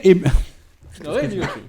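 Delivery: mannered political impression; Henri de Lesquen. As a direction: mimic a politician, high and emphatic. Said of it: "Henri de Lesquen".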